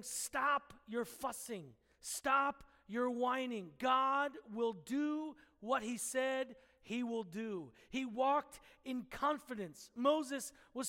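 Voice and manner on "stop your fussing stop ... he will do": slow, deliberate and firm; stern rebuke. Retold or imitated. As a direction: lay it down to settle the fussing people. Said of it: "But he was also saying".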